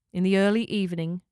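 usual speaking voice

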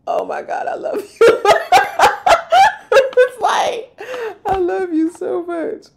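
high, loud, laughing and near tears; overjoyed laughing. Retold or imitated. Priest on the mountain seeing her arrive, laughing and near tears. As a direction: gush through laughter and happy sobs. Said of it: "He was like".

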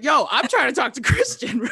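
high, loud protest breaking into laughter; indignant mock yell with a laugh; retold hot protest. Fan going back at interfering wrestler, laughing indignation. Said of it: "legit hot".

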